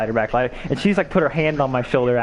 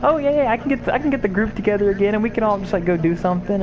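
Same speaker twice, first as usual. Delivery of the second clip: a light mock of an eager girl, voice higher; breezy and a bit higher, quoting her; imitating her; 8 semitones higher, faster than usual. He imitates his crush offering a group outing.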